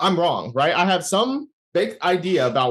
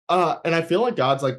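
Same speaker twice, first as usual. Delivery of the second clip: usual speaking voice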